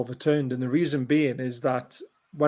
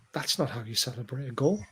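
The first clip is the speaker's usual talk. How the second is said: understated disapproval; 2 semitones lower, 9 dB quieter than usual. Retold or imitated. Fan to a Liverpool-supporting workmate after his little yay, understated disapproval. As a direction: say it quietly, unimpressed. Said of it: "I'm like".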